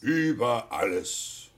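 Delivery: slow, stately declaration; grand proclamation. Acted. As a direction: proclaim it slowly like a monarch. (feierlich verkündet)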